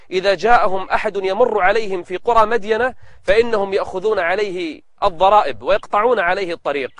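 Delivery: ordinary delivery, nothing marked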